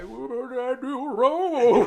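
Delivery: very high, slow, laughing squeak; confused hamster. Retold or imitated. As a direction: stretch it out like a baffled little rodent. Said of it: "he's just like".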